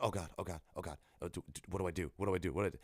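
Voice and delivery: low, soft nervous muttering; hushed retold panic. Player stuck in a bathroom hearing a ghost, hushed panic. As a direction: fret under your breath.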